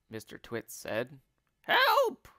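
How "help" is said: very high, loud shriek for help; screamed. A narrator's reading. Wife finds something in her bed, panicked scream. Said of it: "screamed Missus Twit".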